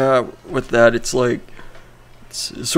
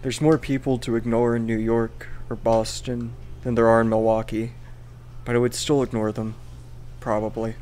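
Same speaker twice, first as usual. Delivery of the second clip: monotone Greinke voice; 8 dB quieter than usual. Retold or imitated. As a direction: read it dead flat, no inflection. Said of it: "Really nailed the monotone Greinke voice there".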